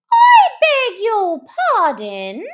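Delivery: high, loud, stiffly polite mouse; frowning but polite. Acted. Mouse rebuking the Lory, frowning but polite. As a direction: bristle politely at the interruption. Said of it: "frowning but very politely".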